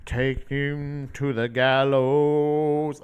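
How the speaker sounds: very slow, drawn-out dramatic line; mock-ominous drawn-out delivery; imitating metal singer. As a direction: stretch it out like a theatrical metal intro. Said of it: "there's one where he's just like".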